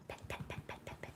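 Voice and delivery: soft rhythmic whisper; hushed repeated pet pet. Real, not acted. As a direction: whisper gently as you stroke the cat. Whispering while petting cat.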